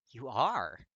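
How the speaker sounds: loud, emphatic rebuttal; insistent contradiction. A narrator's reading. Kirishima insists to Katsuki that he is crying, emphatic. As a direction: push back hard and loud. Insisting against the other's denial.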